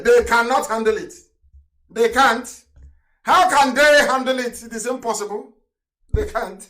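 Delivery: full-force preacher's outburst; fiery preaching. Real, not acted. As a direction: thunder it at the listeners. Fiery radio sermon rant.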